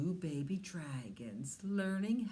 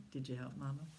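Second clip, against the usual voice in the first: usual speaking voice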